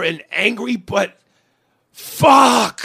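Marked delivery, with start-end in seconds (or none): 1.9-2.8 a louder, higher burst of fuck; a real, louder and higher frustrated shout; frustrated yell. Real, not acted. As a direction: let it out in one yell. Vents frustration at career.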